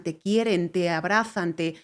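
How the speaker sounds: ordinary delivery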